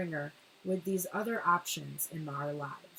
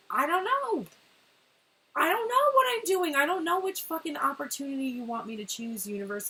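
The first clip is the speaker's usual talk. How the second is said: high, loud, exasperated rant at the universe; frustrated outburst; 7 semitones higher, 7 dB louder than usual. Retold or imitated. The feminine yelling at the universe, frustrated and high. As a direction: throw the words up at the universe in frustration. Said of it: "And I'm pissed now".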